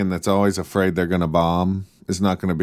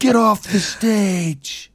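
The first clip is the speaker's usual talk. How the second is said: high, long drawn-out holler; hammed-up jeer at the performer; mock heckler yell; 13 semitones higher, much slower than usual. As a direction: yell it slowly from the back of the hall. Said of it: "Somebody just yells".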